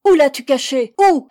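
loud, high angry questioning; insistent demand. A narrator's reading. Girl interrogates the woman, fiercely insistent. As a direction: press hard for the answer. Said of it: "insista-t-elle".

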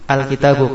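Al-kitabu with clear AL and bare final U; article added, nunation dropped. Shown as a demonstration. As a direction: end Al-kitabu on U without any N. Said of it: "Kitabun menjadi apa?". (Al-kitabu, diawali al dan berakhir u tanpa nun)

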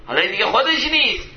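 high, forceful sermon delivery; impassioned preaching. Acted. Preacher warning listeners about misplaced anger, impassioned. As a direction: preach it with rising fervour, then ease down.

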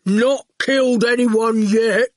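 slow mildly aggrieved goon; goon protesting. A narrator's reading. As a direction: drawl a dim protest. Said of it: "the goon protested".

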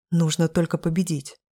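ordinary delivery, nothing marked

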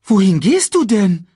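high, loud meerkat question. Acted. Meerkat asking the departing cub, high loud question. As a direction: call it after him high and loud.

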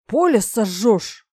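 loud, drawn-out indignant cry. A narrator's reading. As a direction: protest loudly, stretching the words. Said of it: "Я возмутился".

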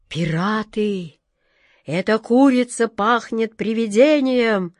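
plain reading voice

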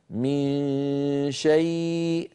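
من شيء drawn out slowly, nasal dominant, no ي sound; correct ikhfa. Shown as a demonstration. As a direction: let the nose carry the نون of من before شيء. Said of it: "لاحظوا بأن الغلبة لصوت الخيشوم".

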